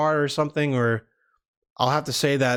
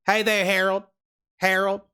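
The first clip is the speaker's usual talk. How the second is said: put-on Southern accent; 4 semitones higher than usual. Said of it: "Should I do the Southern accent?".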